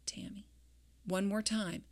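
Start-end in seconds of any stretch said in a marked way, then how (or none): 0.1-0.5 name called gently, much softer; a soft voice calling her name in the dark; soft male voice. Retold or imitated. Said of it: "I just heard this soft male voice".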